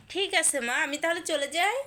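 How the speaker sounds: mother pretending to leave the sleepy child; mock leaving. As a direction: pretend to walk off to get her up.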